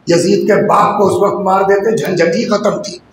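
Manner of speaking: ordinary talk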